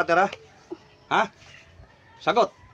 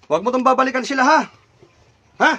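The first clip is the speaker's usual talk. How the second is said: raised, loud, demanding; stern warning; 6 semitones higher, 7 dB louder than usual. Real, not acted. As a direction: warn it off forcefully. Orders spirit not to return.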